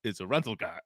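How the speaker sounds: chuckling through the line; laughing quote. Retold or imitated. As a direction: say it while laughing softly. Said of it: "He says".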